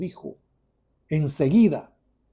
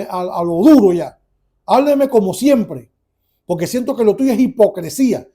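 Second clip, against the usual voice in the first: higher, louder indignant protest; indignant son; 4 semitones higher, 6 dB louder than usual. Retold or imitated. Grown son rebuffing his father's affection, indignant. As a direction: push back at a parent, heated.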